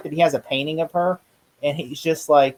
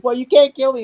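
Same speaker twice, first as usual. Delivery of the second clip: mock taunt; 11 semitones higher, 8 dB louder than usual. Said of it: "Isn't played".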